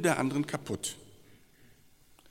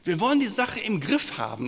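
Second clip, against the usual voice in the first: mock forceful; 8 semitones higher, 6 dB louder than usual. Preacher mocks wanting control.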